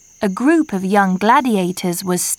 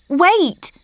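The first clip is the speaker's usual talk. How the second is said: higher, urgent call to stop; sudden cry; 8 semitones higher than usual. A narrator's reading. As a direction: call out suddenly to her friend. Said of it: "Marcia cried".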